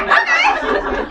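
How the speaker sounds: high, loud, laughing yes; hammed-up eager squeal; eager agreement. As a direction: imitate someone giddily saying yes. Voices lady agreeing happily.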